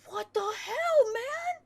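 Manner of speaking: very high, slow, loud horrified cry; shocked soldier. Acted. Soldier seeing his friend spatter blood, high shocked cry. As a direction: recoil from the blood in horror. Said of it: "He goes".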